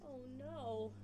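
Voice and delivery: ordinary delivery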